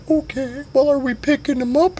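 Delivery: very high squeaky question; a high voice checking the plan; Sugar Tits voice. Acted. Sugar Tits asking about picking up Daddy Nim, high squeak. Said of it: "he's like".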